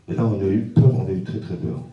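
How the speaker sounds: ordinary talk, nothing marked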